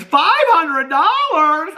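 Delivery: high, drawn-out squeal of disbelief; Becky. Retold or imitated. Quoting a sitcom character on the money, high drawn-out squeal. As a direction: stretch it out slowly in a high, incredulous screech. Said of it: "and Becky goes".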